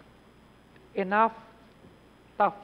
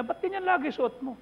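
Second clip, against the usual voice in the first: mock questioning people; 7 semitones higher than usual. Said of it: "sabi nila".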